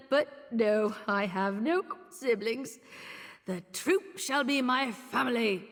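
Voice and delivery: ordinary delivery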